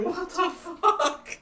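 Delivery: very high, drawn-out squeal; slow, high-pitched baffled cry; mock disbelief. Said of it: "You're just like".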